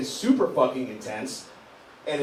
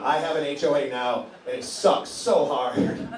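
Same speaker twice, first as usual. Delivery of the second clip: normal talking voice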